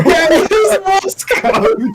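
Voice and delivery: high, drawn-out laughing squeal; laughing agreement. Real, not acted. Host delighted by the Morse code joke, laughing hard. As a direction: cry it out through laughter.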